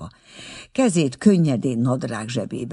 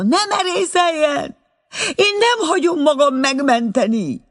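much higher, loud refusal; defiant outburst; 6 dB louder than usual. A narrator's reading. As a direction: shriek her defiance from the snow. Stuck girl refuses rescue defiantly.

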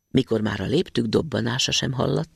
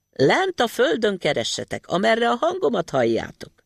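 put-on tiny boy voice; 8 semitones higher than usual. Matyi guiding them.

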